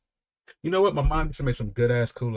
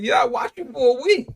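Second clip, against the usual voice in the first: put-on proud little boy; 11 semitones higher than usual. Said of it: "I said".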